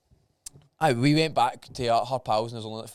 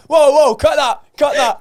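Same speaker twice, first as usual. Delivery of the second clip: mock alarm; 13 semitones higher, 13 dB louder, slower than usual. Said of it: "I'm like".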